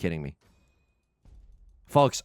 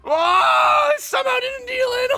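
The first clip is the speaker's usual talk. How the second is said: much higher, loud wailing cry; anguished wail; 4 dB louder, slower than usual. Real, not acted. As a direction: wail as if the game betrayed you. Anguish after a bad draw.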